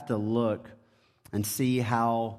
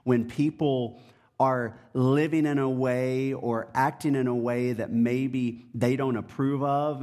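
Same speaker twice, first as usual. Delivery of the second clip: usual speaking voice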